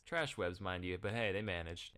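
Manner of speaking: normal talking voice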